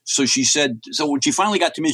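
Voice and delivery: normal talking voice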